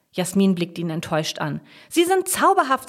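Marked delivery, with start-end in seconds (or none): 1.9-2.9 high, loud, emphatic insistence; stubbornly enthusiastic; insistent. A narrator's reading. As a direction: insist on it, pitch jumping up. Jasmin insists to doubting friend.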